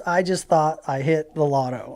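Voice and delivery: ordinary talk, nothing marked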